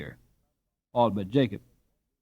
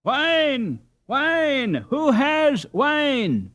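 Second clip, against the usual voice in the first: much higher, slow urgent shouting; shouted together; 12 semitones higher, much slower than usual. Acted. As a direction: cry out for wine for the fallen boy. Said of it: "shouted in concert".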